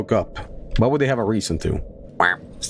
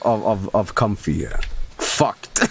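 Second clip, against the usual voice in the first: ordinary talk, nothing marked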